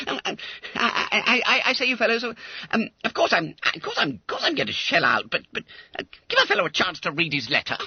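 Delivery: high, stammering schoolboy voice; stumble, then rush out an excuse; flustered stalling. Acted. Fat schoolboy buying time before paying, flustered. Bunter stalls, caught by deal.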